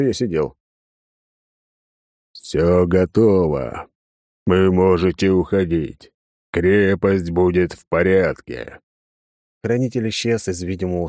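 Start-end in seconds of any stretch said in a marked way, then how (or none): 2.3-8.8 otherworldly booming voice. A narrator's reading. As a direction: drone it like a ghost from beyond. Spirit's final words.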